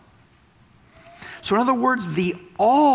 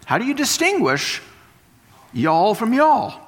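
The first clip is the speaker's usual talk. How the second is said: ordinary delivery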